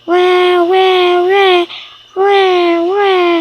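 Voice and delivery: high, loud pretend baby wail; play-acted infant bawling; crying baby. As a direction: imitate a baby going "wah, wah". Baby cries again at thunder.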